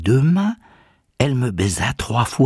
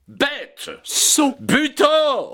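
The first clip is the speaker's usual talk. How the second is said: high-pitched volley of abuse; scornful insults; much slower than usual. Acted. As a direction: throw each insult out separately, very high. Les amis l'insultent tour à tour. (injures méprisantes, voix suraiguë)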